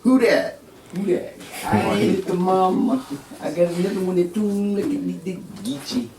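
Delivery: high-pitched Geechee mimicry; put-on Geechee accent. Showing off how they talk down there, high Geechee accent. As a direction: play a Geechee local way up high. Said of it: "I like the way they talk".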